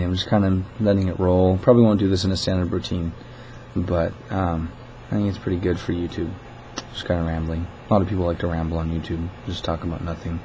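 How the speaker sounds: ordinary talk, nothing marked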